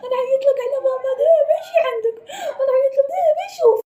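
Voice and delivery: high sobbing threat; crying threat. Acted. Crying kid threatening to call his father. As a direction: wail the threat through tears.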